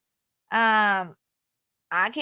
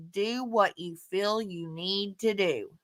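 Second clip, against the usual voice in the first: calm measured reply; 4 semitones lower, 7 dB quieter, slower than usual. Retold or imitated. Calmly replying to angry friend.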